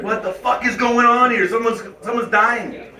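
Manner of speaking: a touch of pretend panic; mock alarm. Bar patron reacting to screaming women, mock alarm.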